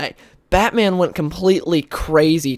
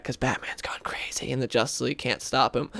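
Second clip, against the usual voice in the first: acted whisper, dropping away; hushed aside; 2 semitones lower, 8 dB quieter than usual. Superman's side planning against crazed Batman, mock hushed. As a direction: drop into a secretive, whispered aside. Acting anxious aside between retold characters.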